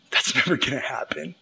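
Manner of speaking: whispered, dismissive scoff. Acted. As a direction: hiss it off as nonsense under the breath. King scoffs at the prophecy.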